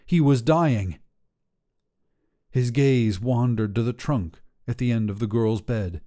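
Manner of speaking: ordinary delivery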